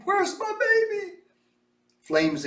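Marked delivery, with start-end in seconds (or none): 0.0-1.1 mother screaming. A narrator's reading. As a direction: wail it in despair. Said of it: "the mother screamed".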